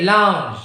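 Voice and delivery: "Lounge" with a clear ow glide; careful model "Lounge"; diphthong demo. Shown as a demonstration. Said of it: "you're going to say lounge".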